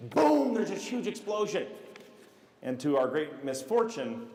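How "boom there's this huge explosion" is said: high, drawn-out boom, then slow awe; excited explosion. Retold or imitated. Storyteller reliving a firecracker blast, excited. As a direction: burst out with the blast.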